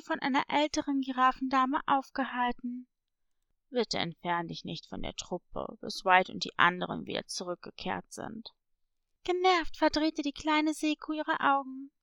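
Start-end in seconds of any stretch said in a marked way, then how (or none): 3.7-8.5 put-on low, hushed elderly giraffe voice; cautioning older giraffe lady. Older giraffe stopping a young one from leaving, low and cautioning. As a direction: drop the voice low and soft to hold her back.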